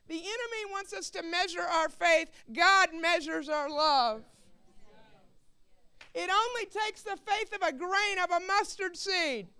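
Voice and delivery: forceful pulpit proclamation; emphatic preaching. Real, not acted. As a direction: preach it out to the whole room, stretching key words. Preacher drives the point home.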